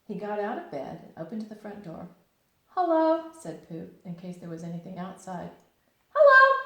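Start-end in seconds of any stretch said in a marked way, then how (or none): 2.7-3.3 high, loud calling hello. Acted. Pooh calling hello out the front door. 6.1-6.6 very high, loud bouncy hello; squeaky bright Tigger greeting. Acted. Tigger answering Pooh's hello outside the door.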